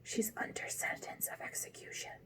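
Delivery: breathy whisper close to the ear. A narrator's reading. Rabbit whispering into Alice's ear, whispering. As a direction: whisper the secret. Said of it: "and whispered".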